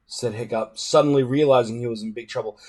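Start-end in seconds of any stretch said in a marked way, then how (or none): none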